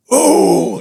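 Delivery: high loud mock crowd ooh; imitating the crowd reacting to a strikeout. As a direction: mimic a stadium groan.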